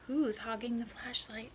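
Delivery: whispered complaint in the dark. Acted. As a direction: hiss it quietly in the dark. Groundhog in dark shadow lesson.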